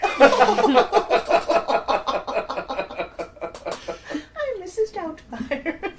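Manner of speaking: put-on high old-lady film voice, laughing; Mrs Doubtfire. Host laughing through a film impression, high hammed-up old lady. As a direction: pitch it way up, slowly, hammed-up. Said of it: "Oh my God, Missus Doubtfire".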